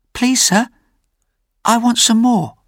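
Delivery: put-on very high little-boy plea; frightened hungry boy. A narrator's reading. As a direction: plead timidly in a high child's voice. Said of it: "He felt very frightened, but also desperate with hunger".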